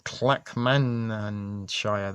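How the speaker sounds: Clackmannanshire spelled out slowly, syllable by syllable; struggling with a long name. Real, not acted. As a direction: drag Clackmannanshire out carefully, as if tripping over it. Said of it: "That's a fucking mouthful".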